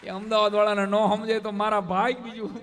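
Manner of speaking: ordinary talk, nothing marked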